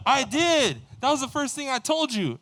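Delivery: high, loud mock-indignant comeback; exasperated protest. Traveler insisting to the guard he said it, exasperated retelling. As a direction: argue back, acting out the frustration.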